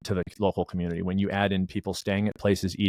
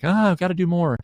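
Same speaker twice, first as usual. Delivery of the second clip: much higher, louder, hammed-up enthusiasm; mock eagerness; 10 semitones higher, 7 dB louder than usual. Guest imitating the marathon enthusiasm he never felt, mock eager. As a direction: play the keen runner he never was. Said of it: "it wasn't like".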